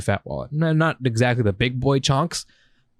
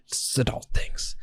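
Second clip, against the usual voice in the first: softly whispered, half to himself; whispered aside; 8 dB quieter, slower than usual. Real, not acted. Explaining adult money stuff to friend, whispered. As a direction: whisper it like a confession.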